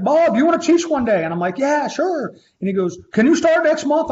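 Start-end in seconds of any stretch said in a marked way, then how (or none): 0.0-1.2 loud, high, excited mimicry; hammed-up enthusiastic invitation; eager offer. Caller's eager offer, acted out. 1.6-2.3 high-pitched, breezy yes; hammed-up keen acceptance; eager agreement. Eagerly accepts offer in retold call. 3.1-4.1 loud, high, excited mimicry; hammed-up enthusiastic invitation; eager offer. Caller's eager offer, acted out.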